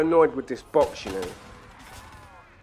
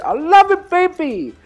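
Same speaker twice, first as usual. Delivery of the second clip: much higher, loud squealing voice; falsetto squeal; 12 dB louder, slower than usual. Pretend. Gamer squeals to his viewers after a headshot, falsetto and loud. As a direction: squeal it out like a delighted diva.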